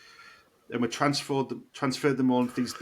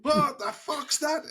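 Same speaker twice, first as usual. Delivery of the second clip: much higher, slow, alarmed what's that; panicked confusion; slower than usual. Retold or imitated. Startled by the spraying skunk, high panicked slow cry. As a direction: stretch it out in rising panic. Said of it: "I'm like".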